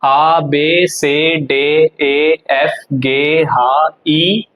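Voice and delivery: slow roll-call of German letters; alphabet recitation. Shown as a demonstration. Teacher going from the beginning, slow spaced recitation. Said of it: "तो starting से start करते हैं".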